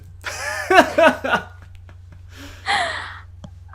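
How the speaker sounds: a loud, drawn-out knowing laugh; long knowing laugh. Real, not acted. Host laughing as he asks his nerdy guest about games, loud knowing laugh. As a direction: laugh out loud, you know what's coming. Host anticipating gamer question answer.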